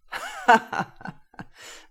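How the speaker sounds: loud, warm burst of laughter; hearty laugh. A narrator's reading. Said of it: "dann begann er herzlich zu lachen". (herzliches Lachen)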